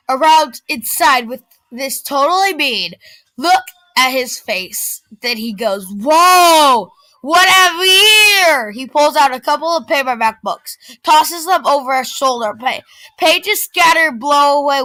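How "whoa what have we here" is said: slow, higher, louder taunting; mock surprise. A narrator's reading. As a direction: act amazed to mock her. Bully rifling her backpack.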